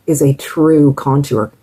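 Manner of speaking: ordinary talk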